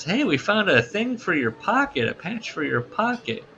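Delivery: high, swooping presenter voice; high-pitched singsong mock presenter; imitated perky woman. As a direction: mimic a woman showing off a prop. Voicing Kathy presenting her prop.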